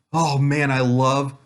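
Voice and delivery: ordinary talk, nothing marked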